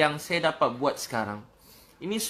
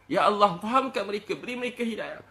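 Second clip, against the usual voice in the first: high, pleading retold prayer; urgent supplication, loud bursts easing at the end; fervent prayer; 7 semitones higher, 4 dB louder than usual. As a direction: plead with God for others, voice raised.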